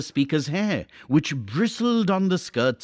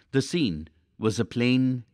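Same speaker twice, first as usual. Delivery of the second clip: ordinary delivery, nothing marked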